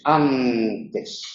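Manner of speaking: antes said softly, tongue on the teeth for the N; soft dental demo. Shown as a demonstration. Teacher showing students the dental N. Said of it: "la lengua está en los dientes".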